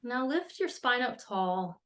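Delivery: measured meditation voice with long pauses between phrases; guided meditation. Acted. Astrologer leading listeners into an earth meditation, measured and calm. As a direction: guide listeners gently into settling, unhurried.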